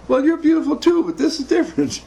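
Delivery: a husband teasing his tearful wife with a squeaky high imitation; wife's overjoyed voice. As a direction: lift way up into a happy wife's voice. Wife answers her husband.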